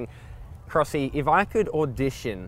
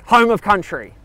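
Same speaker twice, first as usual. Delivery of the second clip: country radio announcer; 3 semitones higher, 8 dB louder than usual. Pretend. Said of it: "Zach, an audition".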